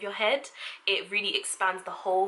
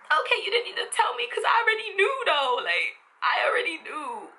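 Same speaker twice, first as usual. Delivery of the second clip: much higher sarcastic clapback; sassy retort; 11 semitones higher than usual. Pretend. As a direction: snap back at the scolding parent. Mock retort to parents.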